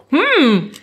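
chewing chips while talking; crunching chips. Real, not acted. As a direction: talk through a mouthful of chips. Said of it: "Sorry, ich esse Chips".

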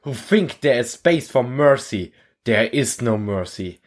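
rally the troops, building fervor; a drill instructor firing up recruits; rousing war speech. Acted. Instructor rallying recruits in the hall, rousing speech.

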